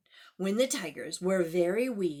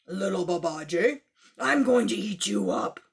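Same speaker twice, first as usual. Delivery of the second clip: low, louder tiger voice; tiger threat; 4 semitones lower, 5 dB louder than usual. Acted. Tiger threatening little Babaji, low and loud. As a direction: loom over him like a hungry tiger.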